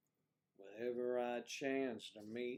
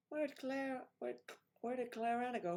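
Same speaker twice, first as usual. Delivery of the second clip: high calling out; 11 semitones higher than usual. Real, not acted. Calling for a missing guest.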